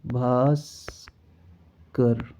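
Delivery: भास्कर stretched out very slowly, each sound clear; drawn-out correct form. Shown as a demonstration. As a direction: draw out भास्कर syllable by syllable. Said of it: "ये वाला".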